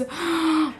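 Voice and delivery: quick breathless gasp; soft awed gasp; gasp of awe. Real, not acted. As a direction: gasp at how pretty it is. Phone revealed.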